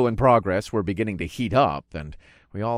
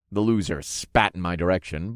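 usual speaking voice

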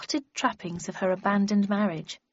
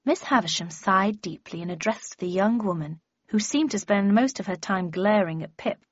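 usual speaking voice

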